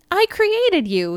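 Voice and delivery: high, slow, gleeful announcement; giddy quote. A narrator's reading. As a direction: imitate a giddy, tipsy boast. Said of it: "Giddy and a little drunk".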